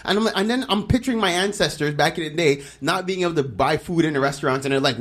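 ordinary talk

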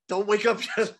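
laughing plea. Retold or imitated. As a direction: beg through a laugh. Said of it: "I was like".

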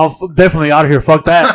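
fast and loud, laughing through it; laughing getaway line. Retold or imitated. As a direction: rush it out, cracking up. Wanting to leave the scene.

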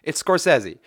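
"Scorsese" said skor-SESS-ee; "Scorsese" with middle syllable like "sess"; correct pronunciation. Shown as a demonstration. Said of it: "it's pronounced Scorsese".

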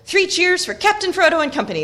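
high, loud hurrah; shouted cheer. A narrator's reading. Friends celebrating the decision with Frodo, shouted cheer. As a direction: shout it out like a toast to a leader. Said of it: "they shouted".

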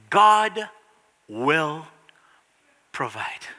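falling from firm to whispered; whispered promise. Acted. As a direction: let it die away into a breath. Repeating line, fading to whisper.

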